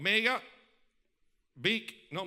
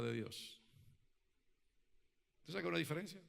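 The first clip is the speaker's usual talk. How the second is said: intimate appeal; 6 semitones lower, 7 dB quieter than usual. Real, not acted. Preacher asking the listeners about God's voice, soft and searching. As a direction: lean in and ask it gently, pausing.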